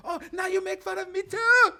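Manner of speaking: put-on high, shouting Chinese-accented woman; hammed-up Chinese-accented outburst; loud Chinese accent. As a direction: cry out as the offended woman. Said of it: "she probably go".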